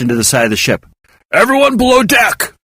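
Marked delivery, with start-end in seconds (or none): 1.3-2.5 shouted order. A narrator's reading. Captain Hawk sending everyone below in the storm, raised order. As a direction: bark it over the wind. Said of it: "Hawk ordered".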